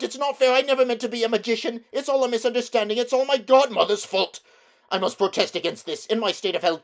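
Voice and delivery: high, loud, fast wailing complaint; wailing old man. A narrator's reading. Uncle Andrew wailing in the darkness, high fast whine. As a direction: whine it in self-pity. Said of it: "it was wailing on".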